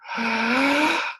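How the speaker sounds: sharp high mock gasp; playful gasp. Teacher pretending the alligator's open mouth is scary, playful gasp. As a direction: gasp in pretend shock for the child.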